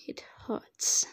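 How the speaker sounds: hurt whisper; pained whisper. Acted. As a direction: barely get the words out through pain. Wounded and in pain.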